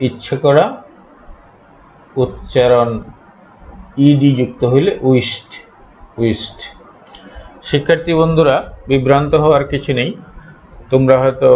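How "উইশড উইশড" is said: "উইশড" said as "wisht"; final -ed as T. Shown as a demonstration. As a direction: close "উইশড" on a voiceless t. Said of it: "ট এর উচ্চারণ হবে". ("উইশড"-এর শেষে ট উচ্চারণ)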